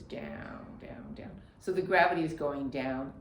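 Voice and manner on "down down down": breathy whisper, fading away; soft whispered release. Shown as a demonstration. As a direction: let the words sink into a whisper. Breath coach releasing exhale.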